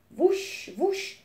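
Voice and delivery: high, rising wing whoosh; wing whooshes. A narrator's reading. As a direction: swoop upward on each wingbeat. Narrator imitates wingbeats.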